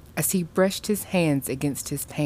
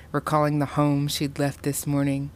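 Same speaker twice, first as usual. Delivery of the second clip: ordinary delivery, nothing marked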